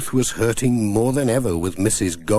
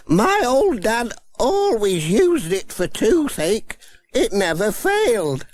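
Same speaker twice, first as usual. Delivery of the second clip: put-on kindly old granny; 12 semitones higher than usual. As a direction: ask with grandmotherly concern. Granny Dryden.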